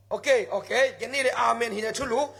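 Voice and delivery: usual speaking voice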